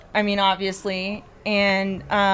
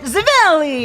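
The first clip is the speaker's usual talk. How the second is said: mock Italian pronunciation, Zivelli with a dz sound; fake Italian accent; 5 semitones higher, 5 dB louder than usual. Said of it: "fake Italian accent".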